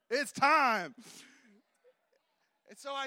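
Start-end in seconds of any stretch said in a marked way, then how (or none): 0.1-0.9 loud, higher rallying cry; loud call. Real, not acted. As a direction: punch it out to the room. Preacher urging crowd.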